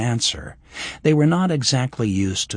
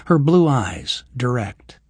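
usual speaking voice

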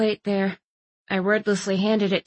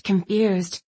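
plain reading voice

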